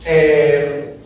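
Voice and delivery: ordinary talk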